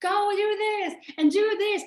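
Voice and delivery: mock coach yell. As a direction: bark orders like a coach. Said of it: "I'm gonna be screaming at you".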